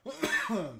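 sharp real cough from choking; coughing fit. Real, not acted. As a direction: cough out the swallowed spit. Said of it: "choking on my spit".

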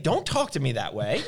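ordinary delivery

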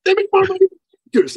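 very high, fast mock voice; greedy client. Client justifying cardiologists, high and fast. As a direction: gush about the money.

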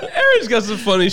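high and loud, still laughing. Real, not acted. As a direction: say it cracking up. Laughing over the picture.

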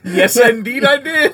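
laughing. Real, not acted. Mock formal reply laughing.

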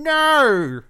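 high, loud hammed-up insistence; mock villain rant. Co-host joins mocking the villain's insistence, loud and high.

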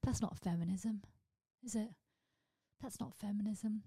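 ordinary delivery

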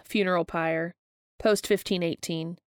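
plain reading voice

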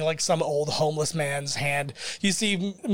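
ordinary talk, nothing marked

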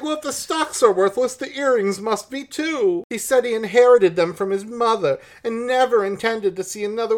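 very high, loud melodramatic actress; hammed-up high theatrical lady voice; Miss Bunny Reynolds. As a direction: declaim it high like a stage diva. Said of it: "So she's like".

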